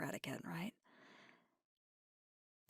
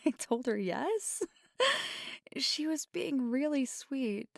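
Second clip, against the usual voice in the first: amused, higher and louder, through giggles; laughing; 6 semitones higher, 5 dB louder than usual. Acted. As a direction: tell it while holding back laughter. Laughs while recounting the encounter.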